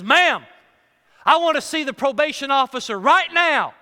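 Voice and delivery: loud, pushy demand. Retold or imitated. Ex-con demanding the secretary fetch his officer, loud and pushy. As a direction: bark at the secretary to get the officer.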